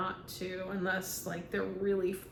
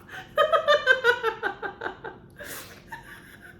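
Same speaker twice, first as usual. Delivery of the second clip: long, high, loud real laugh; laughing at her own impression; 7 dB louder than usual. Real, not acted.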